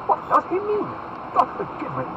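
very high, loud disbelief; ringing, incredulous outburst; astonished exclamation. Acted. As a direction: exclaim in lordly amazement. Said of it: "exclaimed Buckingham".

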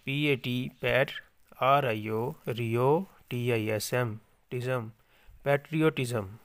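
ordinary talk

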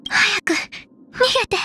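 high, strained plea; urgent alarm. Acted. Fighter urging others to escape, urgent alarm. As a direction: push it out high and desperate. Urging allies to flee in battle.

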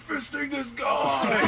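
high, slow laughing shout; mock metal yell. Pointing at a friend in the crowd, laughing mock shout. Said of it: "I'm like".

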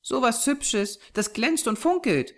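put-on high child's voice of delight; delighted little girl. A narrator's reading. Small girl showing off two glittering stones, delighted. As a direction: marvel in a small girl's high voice. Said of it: "entzückt".

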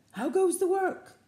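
high, loud call of the lazy farmer; the farmer calling to the duck. A narrator's reading. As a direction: shout it up high from bed. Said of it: "called the farmer".

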